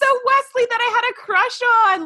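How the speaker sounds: high-pitched gushing recollection; excited fangirl squeal. Retold or imitated. Fan spotting a childhood crush on screen, giddy. Reliving fan delight at seeing Wesley.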